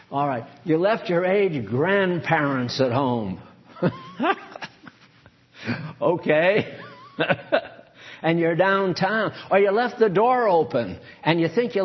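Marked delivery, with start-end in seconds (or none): 3.8-4.7 high-pitched laugh; giggle. Real, not acted. Laughing while preaching. 7.2-7.7 brief high laugh; a quick high-pitched chuckle; short giggle. Real, not acted. Still laughing after his aside.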